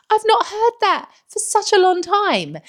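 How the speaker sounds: excited. Real, not acted. Delighted aside in raised voice.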